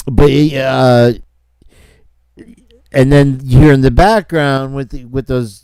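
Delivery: ordinary talk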